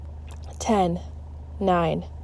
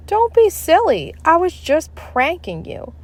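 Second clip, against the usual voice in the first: teasing teacher; 9 semitones higher than usual. A narrator's reading. Teacher laughing at kids.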